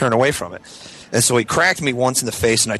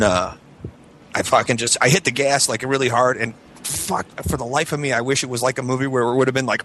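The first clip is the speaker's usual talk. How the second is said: ordinary delivery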